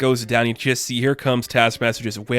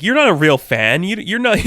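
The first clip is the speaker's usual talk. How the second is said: louder, indignant scolding; getting mad; 6 semitones higher, 3 dB louder than usual. Acted. Coulson scolding a random guy, mock angry. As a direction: tell off a fake fan, rising in pitch. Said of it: "Phil's kinda getting mad".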